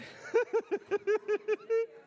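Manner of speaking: high laughing burst; burst of laughter. Real, not acted. Laughter after the joke.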